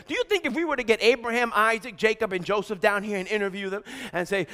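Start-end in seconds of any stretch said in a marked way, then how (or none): none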